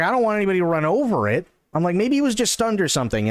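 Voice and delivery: put-on guilty teen fretting to her friend, fast and high.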